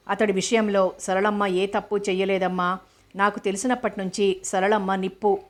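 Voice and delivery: ordinary delivery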